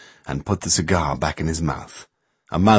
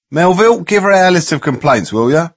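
calling out; 9 semitones higher, 6 dB louder than usual. A narrator's reading. Paul calls across room.

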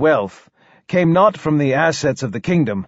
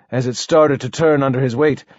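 ordinary delivery

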